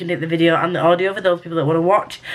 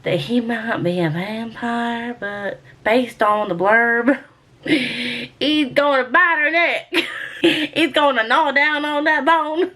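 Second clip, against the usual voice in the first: slow, high Southern drawl breaking into laughter; giggly mock Southern twang; put-on Southern accent; 4 semitones higher, slower than usual. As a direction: ham up a Southern accent, giggling between phrases. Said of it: "I said I was gonna stop".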